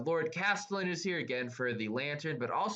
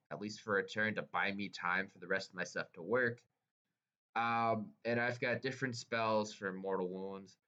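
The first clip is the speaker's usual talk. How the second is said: usual speaking voice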